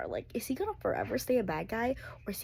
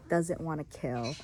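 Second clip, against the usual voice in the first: usual speaking voice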